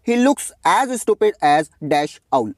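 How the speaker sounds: very slow read-aloud of the exercise. Shown as a demonstration. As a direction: read out very slowly, word by word. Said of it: "reads the exercise sentence aloud".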